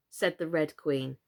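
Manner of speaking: ordinary delivery, nothing marked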